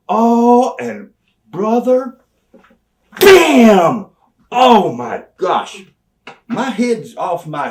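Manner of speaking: high, very loud slowed exclamation; excited reliving. Retold or imitated. Storyteller reliving Brian's punch, loud excited exclamation. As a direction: relive the hit with a big bam.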